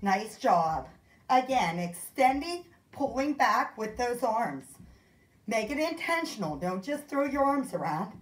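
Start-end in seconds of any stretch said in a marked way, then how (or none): none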